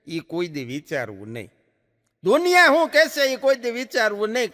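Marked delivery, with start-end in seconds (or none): 2.2-4.5 much higher and louder on દુનિયા શું કહેશે; emphatic repeat. Shown as a demonstration. Speaker repeating his advice to the audience, loud and emphatic. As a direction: hammer the point home the second time. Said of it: "દુનિયા શું કહેશે ને".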